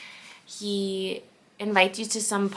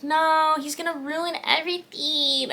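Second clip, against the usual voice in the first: dramatic dismay; 7 semitones higher than usual. Retold or imitated. Reader recalling her first reaction, dramatic dismay. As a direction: wail it as a fan protesting.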